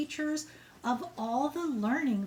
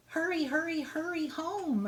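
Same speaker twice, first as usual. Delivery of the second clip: higher, pleading little brother call; 6 semitones higher than usual. Acted. Little brother calling after Tilly as she leaves, higher and pleading. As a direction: draw out the hurrys like a kid begging. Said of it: "her brother called".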